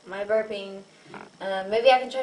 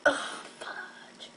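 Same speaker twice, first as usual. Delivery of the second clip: whispered; 7 dB quieter than usual. Real, not acted. Quiet aside between burp attempts.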